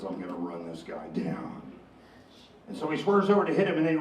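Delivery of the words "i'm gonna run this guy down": muttered threat. Acted. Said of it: "and he says".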